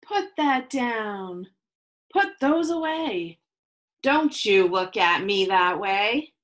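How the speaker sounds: measured rhyming read-aloud with pauses; reading to children. Acted. Teacher reading a picture book to her class, storytelling. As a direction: read each rule out to the kids, lingering.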